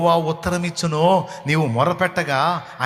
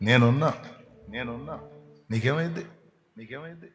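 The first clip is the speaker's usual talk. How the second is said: much softer, lower, fading; hushed comfort; 4 semitones lower, 8 dB quieter than usual. Acted. God comforts the broken-hearted, hushed. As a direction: barely breathe the reassurance.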